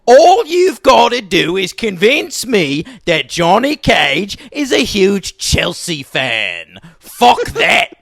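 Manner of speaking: put-on British soccer hooligan accent, drawn out; loud, high-pitched mock British hooligan; Kano impression. Kano bargaining over a Chelsea fan, hammed-up hooligan accent.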